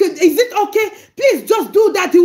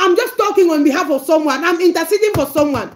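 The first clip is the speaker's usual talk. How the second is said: usual speaking voice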